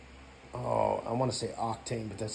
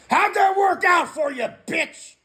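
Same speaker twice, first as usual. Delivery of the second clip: much higher, loud jeering yell, then slowing at the end; taunting shout; 12 semitones higher, 8 dB louder, slower than usual. Retold or imitated. Inner voice mocking his weak sober self, in a high jeering shout. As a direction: scream the taunt like a bully gloating. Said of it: "it's like".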